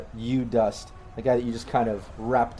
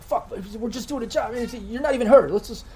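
high-pitched, agitated pleading; wounded henchman's high, nervous appeal; wounded thug pleading; 11 semitones higher than usual. Acted. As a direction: protest in a high voice. Voices the wounded man pleading.

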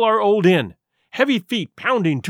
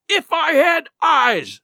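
high, slow, drawn-out wail; frustrated cry; 10 semitones higher, 4 dB louder, slower than usual. A narrator's reading. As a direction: wail it out in helpless rage. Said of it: "he cried".

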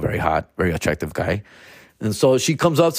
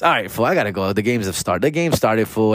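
ordinary talk

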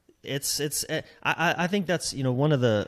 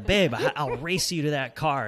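lightly acted competitive dare; louder, higher, laughing challenge; playful challenge; 4 semitones higher, 5 dB louder than usual. Husband daring his wife to race to a car, playful challenge.

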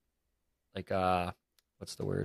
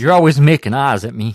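loud teasing; 5 semitones higher, 14 dB louder than usual. Acted. Teasing co-host, big loud jump.

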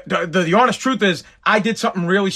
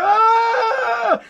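long high-pitched wail; sustained mock scream of panic; hysterical scream. Wife phoning husband at work with an emergency, hysterical scream. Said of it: "I wanna hear hysteria".

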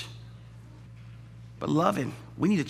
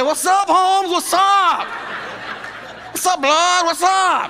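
much higher, louder swaggering calls; hammed-up street calls; mock gang greeting; 12 semitones higher, 4 dB louder, much slower than usual. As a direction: throw gang greetings across the bed. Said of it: "Husband and wife on opposite side".

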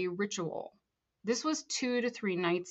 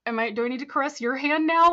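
louder, higher mock-innocent question; 6 semitones higher, 8 dB louder than usual. Student asking the praying man what to do, innocent. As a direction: play the naive innocent, pitched up. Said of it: "literally so innocent going".

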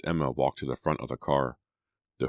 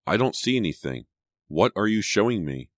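agitated, higher-pitched questioning; alarmed cry; 6 semitones higher, 3 dB louder than usual. A narrator's reading. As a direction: cry it out with a racing heart. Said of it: "she cried, her heart racing".

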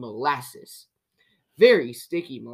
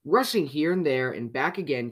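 usual speaking voice

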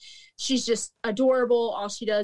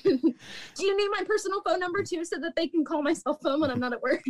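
petty, laughing; 6 semitones higher than usual. Retold or imitated. Petty manager mocking customer.

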